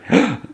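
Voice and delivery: short, high gasp of surprise; acted gasp. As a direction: mimic a startled intake of breath. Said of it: "gasps again".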